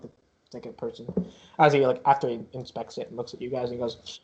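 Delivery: normal talking voice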